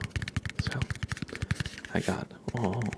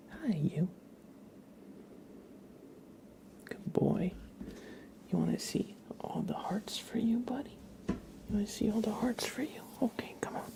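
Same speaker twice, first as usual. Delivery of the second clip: cooing at the cat; 10 semitones higher, 5 dB quieter than usual. Real, not acted. Baby talk to the cat.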